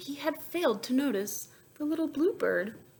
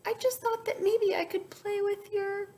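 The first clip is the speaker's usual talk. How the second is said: very high, fast small voice; little bluebird; 13 semitones higher than usual. Acted. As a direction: innocent bird explaining. Bluebird explains.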